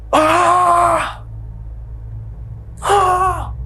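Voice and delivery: a high, piercing scream of terror; wife's scream. Retold or imitated. Wife waking beside the pocong, screaming in terror. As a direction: scream like you woke face to face with a ghost. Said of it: "Bini gue langsung teriak".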